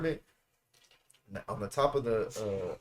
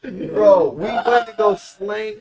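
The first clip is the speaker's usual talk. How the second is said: laughing; 7 semitones higher, 11 dB louder, much slower than usual. Real, not acted. Laughing recalling the spree.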